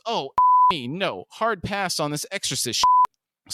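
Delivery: high, drawn-out protesting yell. A narrator's reading. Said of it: "Huey yells".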